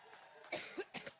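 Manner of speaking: harsh dry cough; coughing fit. Real, not acted. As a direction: cough hard, can't stop. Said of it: "my allergic reaction".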